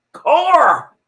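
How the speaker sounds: ordinary talk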